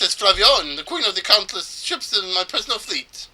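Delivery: put-on high, theatrical Spanish-accented boast. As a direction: show off grandly in a loose Spanish accent. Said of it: "I think it's supposed to be Spanish".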